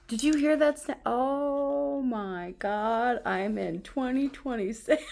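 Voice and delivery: high, real squeal of wonder; overjoyed amazement. Real, not acted. Vlogger thrilled by the snap of her new case, overjoyed. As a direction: stretch the exclamation out, high and giddy.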